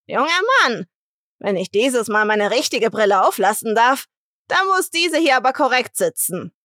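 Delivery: high, loud, firm demand with "Junger" stressed and falling; resolute old woman. Acted. Marita instructs the assistant, high loud and resolute. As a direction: lay down the law to the young man. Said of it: "Maritas Stimme ist tief und resolut".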